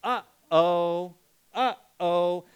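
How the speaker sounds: melodic two-note uh-oh; playful singsong uh-oh; sing-song uh-oh. Shown as a demonstration. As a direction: chant it like a child. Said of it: "melodic pitch".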